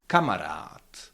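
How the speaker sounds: "kamarad" with every a a full open ah, the last long; full vowels. Shown as a demonstration. Teacher showing vowels never weaken, full-vowel "kamarad". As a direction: give each a in "kamarad" a full ah, never a schwa. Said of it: "All the vowels ... are pronounced fully".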